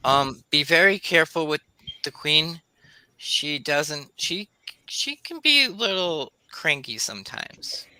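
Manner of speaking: a small creature's careful warning. Acted. DM voices guide creature.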